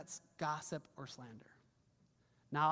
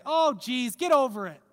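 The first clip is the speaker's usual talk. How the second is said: dismissive scoff; 5 semitones higher, 10 dB louder than usual. Retold or imitated. Dismissive person voiced.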